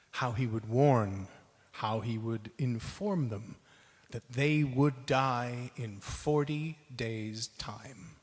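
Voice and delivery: ordinary delivery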